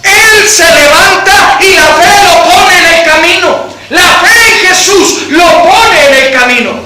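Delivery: rising, swelling sermon delivery; preaching at full force. Acted. Preacher swelling before the congregation, full force.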